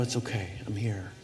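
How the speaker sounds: tender reassurance. Pretend. Parent at the bedside comforting a child after a bad dream, soft and tender. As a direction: hush a frightened child gently. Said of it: "You let them know".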